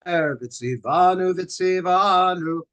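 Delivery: רב מברך על הנרות בניגון; candle blessing. Acted. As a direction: chant the Shabbat candle blessing.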